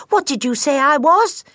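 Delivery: put-on high, loud childlike voice; young gosling. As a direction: call out like an eager little gosling. Gosling questions his mother.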